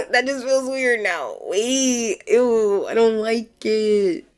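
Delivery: slow, high, grossed-out whine. Real, not acted. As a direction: drawl it out, disgusted. Realizing awkward wording.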